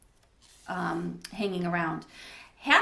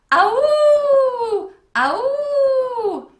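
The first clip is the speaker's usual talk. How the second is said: very loud, high, long howl; hideous howl; 11 dB louder than usual. A narrator's reading. The Howler answers the campers, hideous howl. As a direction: howl like a monster in the woods. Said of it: "The noise grew closer".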